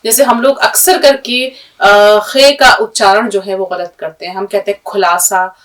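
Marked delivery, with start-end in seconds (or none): 4.9-5.6 खुलासा with a hard aspirated kh, not the throaty kh; wrong kh sound. Shown as a demonstration. Teacher demonstrating the common mistake, hard kh. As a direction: say खुलासा as khulasa, a plosive kh. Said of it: "ये सब गलत है".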